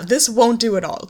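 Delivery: usual speaking voice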